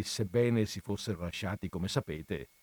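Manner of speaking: ordinary delivery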